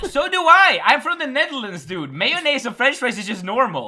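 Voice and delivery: high-pitched, worked-up comeback; heated protest. Real, not acted. Dutch friend defending mayonnaise on fries, heated protest. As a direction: fire back indignantly, pitched well up.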